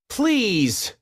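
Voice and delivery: high, pleading please; little lemur begging. Acted. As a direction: beg like a small eager lemur. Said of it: "Mort begged".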